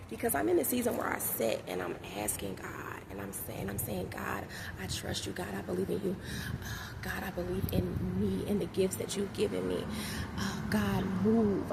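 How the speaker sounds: quiet earnest prayer; hushed prayer. Real, not acted. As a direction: pray it softly to God.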